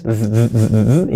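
isolated buzzing "th" repeated; tongue-between-teeth th. Shown as a demonstration. Teacher shows the important sound, tongue between teeth. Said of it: "lengua entre los dientes".

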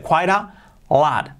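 "Quite a lot" slowly with the t of quite as a soft tapped r, "quai-ra lot"; slow demo of "Quite a lot" with a flapped t. Shown as a demonstration. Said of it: "La T, ¿cómo es suave?".

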